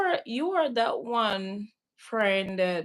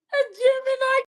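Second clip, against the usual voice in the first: very high, louder squeal; excited squeal; 13 semitones higher, 5 dB louder, faster than usual. Real, not acted. Friend suddenly remembering a star sign, excited squeal. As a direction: burst out high with sudden recall.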